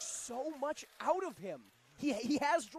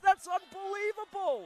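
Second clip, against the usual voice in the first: high, loud disbelief; amazed shout; 8 semitones higher, 8 dB louder than usual. Real, not acted. Commentator amazed by a boot to the post, loud disbelief. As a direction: exclaim it in astonishment.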